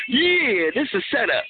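normal talking voice

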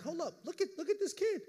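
high astonished cry; amazed. Retold or imitated. Father seeing son run, high amazed cry. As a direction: marvel at the running kid, up high. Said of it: "I'm like".

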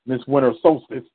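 normal talking voice

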